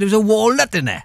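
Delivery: put-on Welsh accent, breaking into laughter; laughing Welsh punchline. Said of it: "you can really do Welsh accents".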